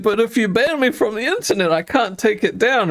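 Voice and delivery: high, smart-alec reply; cheeky son. Retold or imitated. Son talking back to mum, high cheeky voice. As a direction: point out the loophole.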